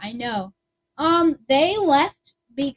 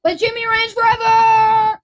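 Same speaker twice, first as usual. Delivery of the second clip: defiant shout; 9 semitones higher, 7 dB louder, slower than usual. Acted.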